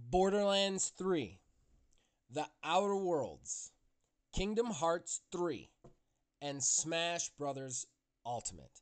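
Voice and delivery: slow, drawn-out nominee reading; suspenseful awards presenter. Acted. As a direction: read each nominee with weight.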